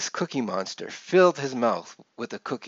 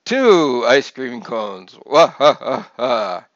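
booming, slow Transylvanian count; big theatrical Transylvanian vampire laugh after the count; Transylvanian accent with gusto; 9 dB louder, slower than usual. A narrator's reading. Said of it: "with a little more gusto".

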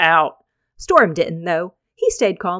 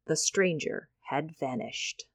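hushed dramatic close; 3 semitones lower, 10 dB quieter, slower than usual. A narrator's reading. Dramatic end of chapter.

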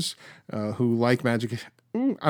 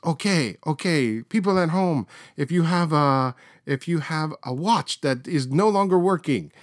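Uri Geller on TV; 5 semitones higher than usual. Retold or imitated. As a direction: command the home viewers like a showman psychic. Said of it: "and he says".

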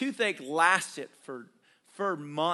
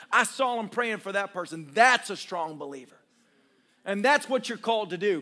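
fervent pulpit preaching, louder; 3 semitones higher, 4 dB louder than usual. Acted. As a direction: drive it home to the congregation. Preaching to congregation.

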